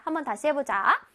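normal talking voice

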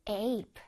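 ape with a drawn-out vowel; long APE. Shown as a demonstration. Teacher drilling the end of grape, long drawn-out ape. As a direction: stretch the ay in ape. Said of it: "에이피이는 길게".

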